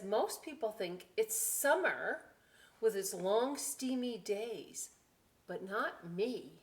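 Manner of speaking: put-on lively read-aloud to children; animated picture book reading; story reading. A narrator's reading. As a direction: read it brightly for the kids.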